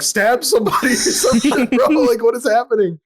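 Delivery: high-pitched, laughing mock alarm; laughing disbelief. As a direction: retell your panic through rising laughter. Retold alarm at tweaker, laughing.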